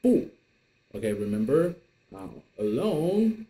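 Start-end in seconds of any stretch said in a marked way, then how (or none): 0.0-0.3 不 falling sharply; teacher's short falling 不; 不 in fourth tone. Shown as a demonstration. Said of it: "the fourth tone".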